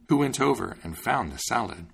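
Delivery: usual speaking voice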